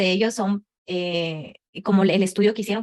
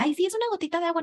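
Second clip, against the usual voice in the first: breezy dismissal; 8 semitones higher, 3 dB quieter, much faster than usual. Retold or imitated. Dismissing a small leak.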